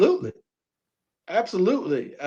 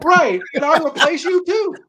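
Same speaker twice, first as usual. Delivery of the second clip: Magic's cocky comeback; 10 semitones higher than usual. Acted. Joins in as Magic threatening coach.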